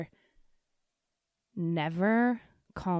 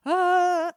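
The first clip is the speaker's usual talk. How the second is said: sudden high, loud yelp of fright; a jolt of fear squeezed into one high cry; startled yelp; 12 semitones higher, 8 dB louder than usual. Retold or imitated. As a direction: caught off guard by a stranger's voice. Hears a strange woman screaming.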